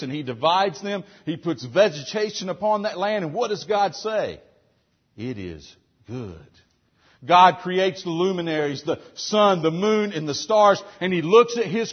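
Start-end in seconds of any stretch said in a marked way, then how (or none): 5.2-6.4 hushed, slow voicing of God.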